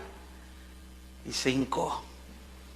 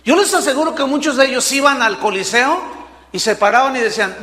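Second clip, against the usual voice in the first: ordinary talk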